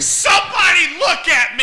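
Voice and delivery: high yell for attention; attention-seeking shout. Pretend. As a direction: demand everyone look.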